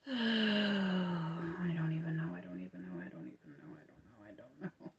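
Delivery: sighing into a very low, hushed mumble; resigned mumble. Real, not acted. Crocheter tired of her phone doing weird things, resigned mumble. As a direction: trail into repeated, barely audible grumbling.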